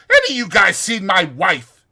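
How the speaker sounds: Curly demanding his wife's whereabouts. Acted. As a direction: bark it out looking for his wife. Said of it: "he demanded".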